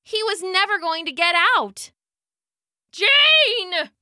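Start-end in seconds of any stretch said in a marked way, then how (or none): 2.9-3.9 much higher, loud call; barked. A narrator's reading. Lost dog barks for Jane, loud high call. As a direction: bark her name into the woods. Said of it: "he barked".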